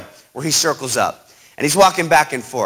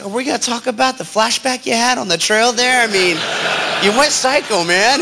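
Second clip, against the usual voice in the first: incredulous; 7 semitones higher than usual. Retold or imitated. Incredulous question to Scott.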